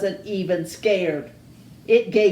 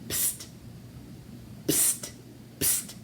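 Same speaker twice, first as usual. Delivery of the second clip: whisper; 15 dB quieter than usual. Acted. Wolf hissing for attention.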